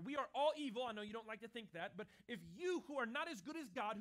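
normal talking voice

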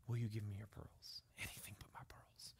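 unvoiced, soft, almost breathless; hushed bedtime whisper. Father and daughter whispering at her bedside. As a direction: keep it to a whisper.

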